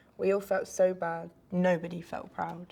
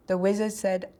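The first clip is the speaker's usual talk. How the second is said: ordinary delivery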